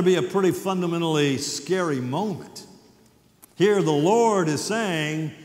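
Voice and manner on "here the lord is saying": ordinary delivery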